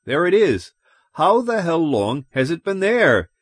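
agitated, high-pitched demand; worked-up, indignant questioning; voice rising excitedly. A narrator's reading. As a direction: let alarm lift the pitch. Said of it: "His voice rose excitedly".

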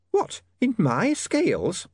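high, slow perplexed landlady; slow, puzzled landlady; shrill nagging landlady. Acted.

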